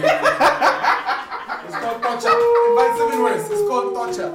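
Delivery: shouting high and loud. Real, not acted. Outburst calling it torture.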